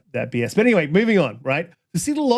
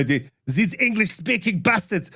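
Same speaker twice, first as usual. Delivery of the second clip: a mock French accent; lightly faked French accent, slowed down; bad accent; 5 semitones higher than usual. Streamer mocking himself, a bad slow French accent. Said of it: "that was more French than it was German".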